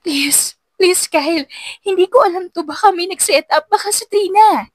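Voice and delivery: high, tearful begging; desperate pleading; pleading in tears. A narrator's reading. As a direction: beg him to believe you, voice rising. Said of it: "Nanginginig ang tuhod niya habang lumuluha".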